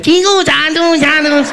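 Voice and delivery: a hammed-up gibberish announcement; mock Chinese announcer. Comic mocking what audiences imagine Chinese airports sound like. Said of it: "think they'll be like".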